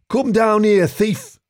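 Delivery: high, loud shout up at a fugitive; angry shout. Acted. Skunk shouts up at the fleeing fox, shouting. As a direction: bellow it upward at a thief. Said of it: "shouted Skunk through the puff of smoke".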